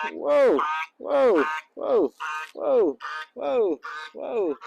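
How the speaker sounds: amazed exclamations tumbling out; excited whoas. Real, not acted. Ducks splashing wildly nearby.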